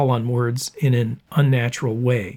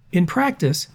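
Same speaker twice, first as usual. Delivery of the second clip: normal talking voice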